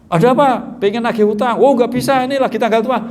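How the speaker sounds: a mock-indignant debtor turning him away; brushing off collector. Debtor rebuffs debt collector.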